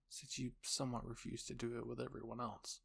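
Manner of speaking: ordinary delivery, nothing marked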